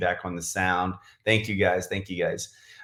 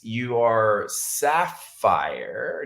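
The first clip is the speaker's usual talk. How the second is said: drawn-out, halting recall of the rank; unsure, searching; much slower than usual. Real, not acted. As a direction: say it slowly, feeling for the word.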